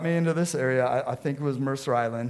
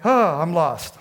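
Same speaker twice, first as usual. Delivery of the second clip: frantic; 3 semitones higher, 6 dB louder, slower than usual. Retold or imitated. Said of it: "started to get that frantic".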